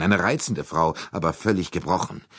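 plain reading voice

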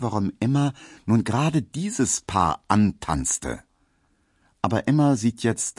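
plain reading voice

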